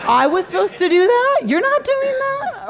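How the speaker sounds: high, squeaky mock-panicked client. Client discovering nobody does content, high mock panic. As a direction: play the client suddenly realising, in mock alarm. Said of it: "They're like".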